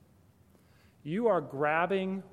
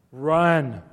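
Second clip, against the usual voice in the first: loud command; 12 dB louder than usual. Acted. Preacher warns teachers facing a crying mother, loud. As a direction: blurt it out much louder, a sudden warning.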